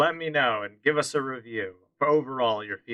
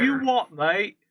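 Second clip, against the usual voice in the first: higher-pitched mock British accent; 10 semitones higher, 3 dB louder, slower than usual. Cohost teasing with British slang, in a mock British accent. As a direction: put on a bad British accent. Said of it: "very good British accent".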